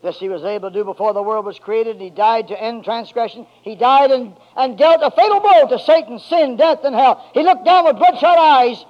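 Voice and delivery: loud, high, rising preacher's cry; full-force preaching. Acted. Preacher building to a climax before the church, loud and high. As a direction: drive it home to the congregation.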